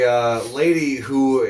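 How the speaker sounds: normal talking voice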